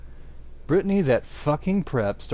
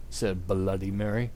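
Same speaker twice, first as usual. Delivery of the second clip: ordinary delivery, nothing marked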